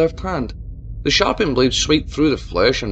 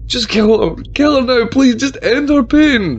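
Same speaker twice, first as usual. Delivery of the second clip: much higher, louder, anguished roar; roaring plea; 11 semitones higher, 8 dB louder than usual. A narrator's reading. Mayor roars at Tabitha to end it. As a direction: roar in desperation. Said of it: "the mayor roared".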